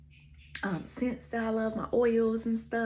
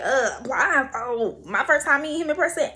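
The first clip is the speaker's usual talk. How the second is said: incredulous; 6 semitones higher, 7 dB louder than usual. Retold or imitated. Incredulous reaction to blindfold.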